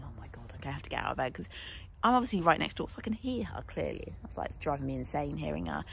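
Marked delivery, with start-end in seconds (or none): 0.0-1.3 whispered thought. Retold or imitated. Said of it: "I was thinking".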